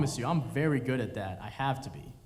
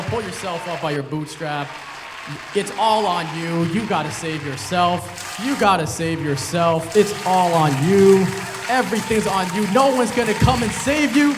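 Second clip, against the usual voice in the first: loud, building mock self-help rant; louder and louder, hammering it home; mock ranting mantra; 3 semitones higher, 12 dB louder than usual. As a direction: shout it like a preacher of tough love.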